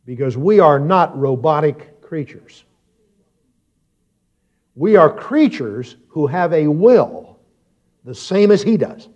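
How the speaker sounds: high, deliberate pulpit proclamation; emphatic sermon, key words stretched out; preaching. Real, not acted. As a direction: drive the point home to the congregation. Preaches to congregation.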